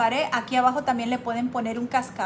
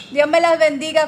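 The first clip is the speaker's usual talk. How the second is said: loud blessing; 4 semitones higher, 10 dB louder than usual. Real, not acted. Loud blessing after a laugh.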